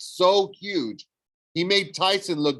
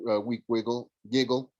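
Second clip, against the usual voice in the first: plain reading voice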